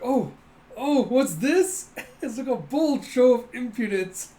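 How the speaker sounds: high, loud, drawn-out mock surprise; hammed-up indignation tipping into laughter; mock astonishment. Acted outrage breaking into laughter.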